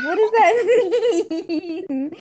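high, slow words breaking into laughter; laughing question. Real, not acted. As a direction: ask it while cracking up. Cracks up at the criminal background.

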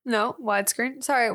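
normal talking voice

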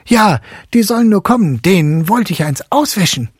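put-on high, loud, fast boy's bravado; brave boy. A narrator's reading. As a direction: brag with sabre drawn. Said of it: "seinen Säbel gezogen und sprach".

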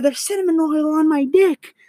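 high-pitched, strained panicked reply; mock high panic; panicked cry. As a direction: blurt it out in burning pain. Retelling panicked answer to dad.

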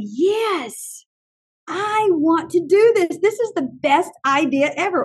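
imitated gushing keenness; gung-ho enthusiasm. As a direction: act out a teacher wholly sold on an idea. Teachers' eager reaction versus hesitant one.

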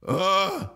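short loud stoned grunt; a loud lazy grunt instead of words; mock grunt. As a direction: grunt it out, one syllable. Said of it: "he go like this".

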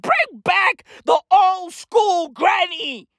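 high, slow, drawn-out holler; stretched-out high shout; climactic plea. Acted. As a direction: bellow it like a rallying cry. Climax of the granny rant.

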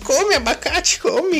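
very high, slow cartoon granny; grandma voice. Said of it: "a vovó é uma personagem".

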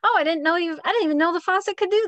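high and loud, quick surprise; mock amazed kid. Kid discovers the faucet sprayer, high amazed voice. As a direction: gasp with delight at the faucet. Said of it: "it's like".

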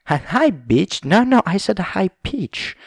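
mock high pitch demo; squeaky high voice. Shown as a demonstration. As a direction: say it way up high and loud. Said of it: "that was really high, high pitch".

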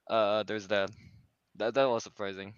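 ordinary talk

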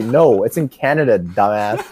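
usual speaking voice

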